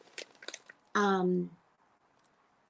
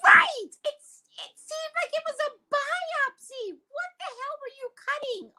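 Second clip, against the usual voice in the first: outraged squeal. Real, not acted. Outraged recap of the biopsy.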